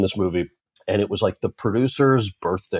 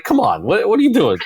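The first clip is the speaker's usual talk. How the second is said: high-pitched, louder mock protest; playful exasperation; 12 semitones higher, 5 dB louder than usual. Actor chiding an industry that celebrates too early, playfully exasperated.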